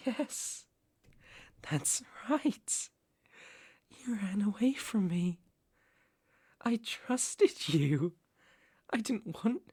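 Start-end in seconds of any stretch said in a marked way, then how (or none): 0.1-5.4 hushed, breath-only voice; whispered accusation. Acted. Captor confronts the one who ran away, whispered accusation. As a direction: whisper it as a soft threat.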